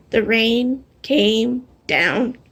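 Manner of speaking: slow, drawn-out storybook refrain; hushed, slow chorus line. A narrator's reading. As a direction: let the refrain fall slowly. Refrain slowed.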